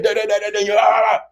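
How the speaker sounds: high babble rising into a shout; mock yelling. Couple yelling back and forth, mock screaming. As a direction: imitate a screaming row in nonsense syllables. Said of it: "you're yelling and you're screaming".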